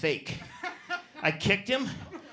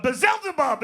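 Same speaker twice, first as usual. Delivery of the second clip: mock summoning; 8 semitones higher, slower than usual. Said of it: "I could go".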